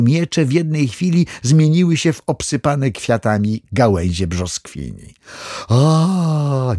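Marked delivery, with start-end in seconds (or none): 5.7-6.7 high, drawn-out "ah" of amazement; acted cry of wonder; astonished gasp. A narrator's reading. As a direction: let out an amazed, lingering "aah". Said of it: "echo zdumionych głosów".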